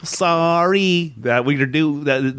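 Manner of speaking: slow, drawn-out "Sorry"; hammed-up stretched apology; mock apology. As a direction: drag out "Sorry" in jest. Repeating the running mock apology.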